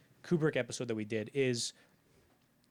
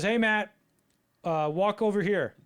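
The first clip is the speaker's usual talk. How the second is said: louder, higher retold radio instruction; calling out; 6 semitones higher, 6 dB louder than usual. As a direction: call him over, drawn out and raised. Director calling actor over radio.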